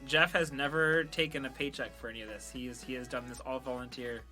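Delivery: usual speaking voice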